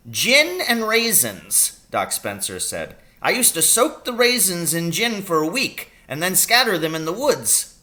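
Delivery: a brisk country doctor fussing over his patient; little old doctor voice. A narrator's reading. Doctor asking his injured patient about the pain.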